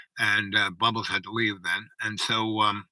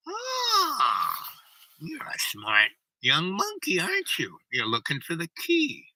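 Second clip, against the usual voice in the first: high drawn-out "Ah", then slow, raised voice; crone's uncanny greeting; 6 semitones higher, slower than usual. Retold or imitated. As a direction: imitate an old crone sizing up a newcomer. Retold crone speaking up close.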